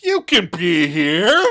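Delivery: high, loud, drawn-out blurt; loud announcement. Retold or imitated. Joey answering a whispered request aloud, loud announcing. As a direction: blurt it out slowly for all to hear. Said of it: "But Joey just announces".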